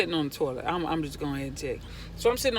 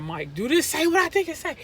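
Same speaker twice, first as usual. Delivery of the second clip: rising from high to a very high, loud, fast squeal; freaking out; 12 semitones higher, 10 dB louder, faster than usual. Retold or imitated. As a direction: build into a frantic shriek. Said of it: "I'm freaking out".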